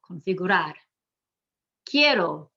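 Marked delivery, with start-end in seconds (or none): 0.1-0.7 "configurar" stressed con-fi-gu-RAR; last-syllable stress. Shown as a demonstration. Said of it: "that's the last syllable".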